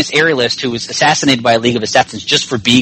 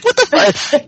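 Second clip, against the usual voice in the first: mock outrage; slower than usual. Acting out Carol in a fight.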